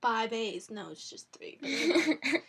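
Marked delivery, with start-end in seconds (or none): 0.7-1.6 soft unvoiced whisper, much quieter; whispered aside. Real, not acted. Host correcting her own count in a whispered aside. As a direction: drop to a whisper to correct yourself.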